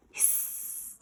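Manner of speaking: breathy hiss; cat hiss. Acted. Cats attacking, breathy hiss.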